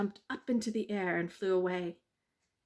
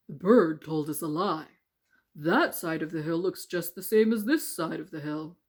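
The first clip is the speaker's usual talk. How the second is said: stone voices; 2 semitones lower, 7 dB louder than usual. Acted.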